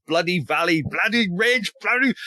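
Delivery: exasperated litany climbing in pitch; mock fed-up. Historian voicing troops weary of endless ridges, mock fed-up. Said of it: "they get so fed up".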